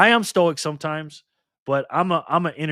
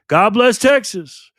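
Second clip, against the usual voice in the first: slow proud proclamation; 6 semitones higher, 9 dB louder, much slower than usual. Real, not acted. Proclaiming a viewer's chat line.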